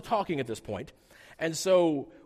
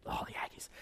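weary groan; 13 dB quieter than usual. Retold or imitated. Fans groaning about the Aggies.

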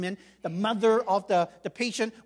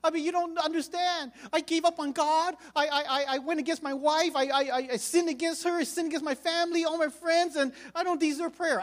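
higher, distressed confession; guilty sick man, higher and anguished; pleading husband; 7 semitones higher than usual. Retold or imitated. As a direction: plead unworthiness in a raised voice.

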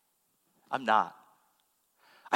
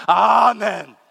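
mock congregation amen; 4 semitones higher, 12 dB louder than usual. Congregation bursts out laughing.

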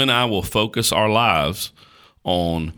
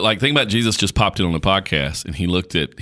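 usual speaking voice

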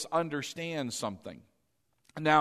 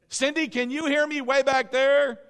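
loud, raised call to the back row; 9 semitones higher, 6 dB louder than usual. Real, not acted. Preacher to a listener way back there, calling out. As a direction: project high and strong across the room.

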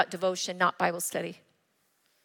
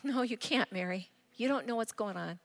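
gentle rebuke; 10 dB quieter than usual. Retold or imitated. God answering her.

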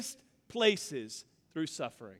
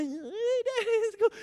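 put-on much higher, childlike squeal; little kid; 13 semitones higher than usual. Boy running to his dad, a much higher kid voice. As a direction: pitch it up like an excited small boy.

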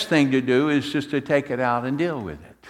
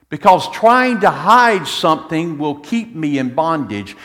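ordinary delivery

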